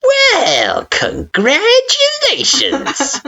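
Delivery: high, slow, dripping sarcasm; mock-cheerful congratulations; sarcastic. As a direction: drag out congratulations with scorn. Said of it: "the most sarcastic tone he could muster".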